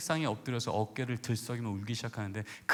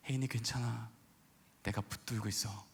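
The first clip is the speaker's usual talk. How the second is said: hushed divine voice; 3 semitones lower, 7 dB quieter than usual. Retold or imitated. Retells God's voice to wife.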